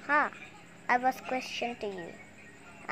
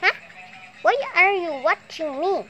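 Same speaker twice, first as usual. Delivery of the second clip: much louder, higher protest; indignant outburst; 6 semitones higher, 9 dB louder than usual. Pretend. Toy demanding why the other is watching, loud indignant. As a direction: shout it out, caught off guard.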